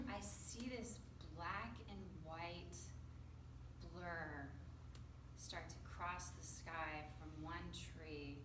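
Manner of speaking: slow, soft, sinking storyteller voice; hushed suspense. Acted. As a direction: draw the moment out slowly and quietly. Suspenseful sighting of the bird.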